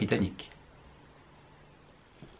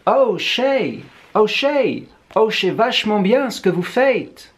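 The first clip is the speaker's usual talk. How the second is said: much higher and louder, gushing in a hammed-up English accent; bad English accent; 10 semitones higher, 6 dB louder, slower than usual. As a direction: fawn over him like an English fan, badly faking the accent. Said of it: "j'imite très mal l'accent anglais". (mauvaise imitation d'accent anglais, aiguë et enthousiaste)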